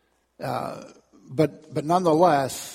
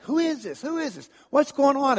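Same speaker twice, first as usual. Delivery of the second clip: higher, wondering crowd voice; puzzled onlooker; 10 semitones higher than usual. Retold or imitated. Preacher voicing confused people in the street, higher pitched. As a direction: ask it like a curious bystander. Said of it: "people were like".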